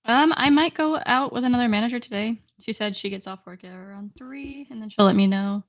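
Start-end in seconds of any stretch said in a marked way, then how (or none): 2.6-4.9 suddenly quieter. Real, not acted. Manager's plans told to a friend, suddenly quieter. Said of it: "Did you get quieter again?".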